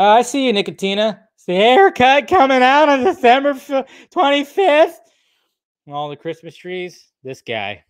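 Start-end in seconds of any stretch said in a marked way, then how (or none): 0.0-5.0 teasing. Acted. Mock-teasing a chatter.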